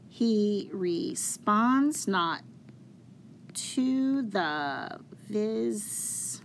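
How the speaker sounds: very slow scansion, "responds" stressed on its second syllable; drawn-out syllables, leaning on "vis"; alternating metrical stress. Shown as a demonstration. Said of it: "that pattern of that stressed and unstressed".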